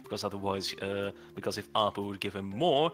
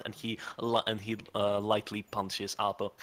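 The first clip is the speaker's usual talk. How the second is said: usual speaking voice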